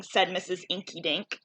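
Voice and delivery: plain reading voice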